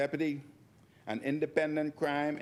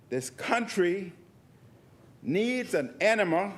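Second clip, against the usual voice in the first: raised, higher pitched declaiming; loud insistence; 7 semitones higher, 4 dB louder than usual. Real, not acted. MP demanding the House listen, loud and high. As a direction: call it out to the chamber. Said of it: "listen to me".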